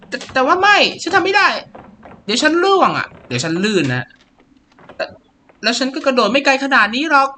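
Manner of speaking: put-on high, anxious girl pleading; scared girl. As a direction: play the frightened girl refusing to jump. Voicing scared Sissy dialogue.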